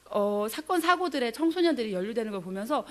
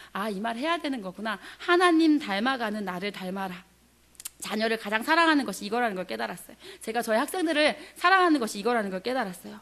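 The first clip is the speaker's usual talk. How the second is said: normal talking voice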